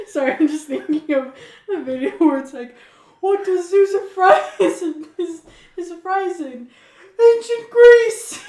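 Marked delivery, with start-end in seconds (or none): 3.2-8.4 high, slow hammed-up joke voice rising to a loud yell; drawn-out silly quiz voice; mock announcer punchline. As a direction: ham up the punchline like a meme video. Joke punchline, quoting a video.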